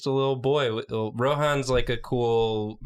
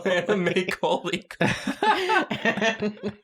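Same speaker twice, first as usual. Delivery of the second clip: laughing; 7 semitones higher, much slower than usual. Real, not acted. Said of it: "Everybody at home, try it".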